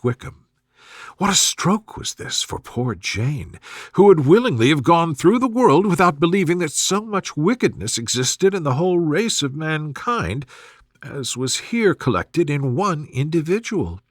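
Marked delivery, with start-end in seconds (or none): none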